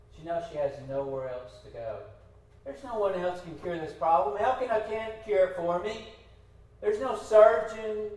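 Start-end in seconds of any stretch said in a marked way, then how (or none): 2.6-8.1 higher, louder voicing of her plea; desperate woman. Hannah reasons that only God can help, imitated with raised insistence. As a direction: build up, then drop on the last words.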